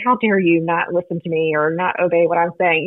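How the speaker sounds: ordinary delivery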